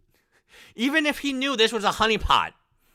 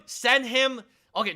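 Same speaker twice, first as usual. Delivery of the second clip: ordinary talk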